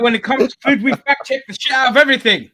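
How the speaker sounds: high, loud joking outburst; hammed-up fury, bellow it out; mock rant. Defending the friend group's food pedantry, loud mock rant.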